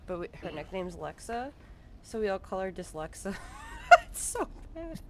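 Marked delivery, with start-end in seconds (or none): 3.4-5.0 laughing. Real, not acted. Laughing at sister's nickname.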